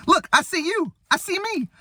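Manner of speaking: high-pitched, giddy comic amazement; excited high falsetto, drawing out the last words; Eddie Murphy. Retold or imitated. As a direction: squeal it like a hammed-up movie comedian. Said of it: "Eddie Murphy's like".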